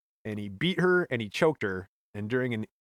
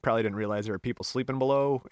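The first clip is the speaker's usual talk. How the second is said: normal talking voice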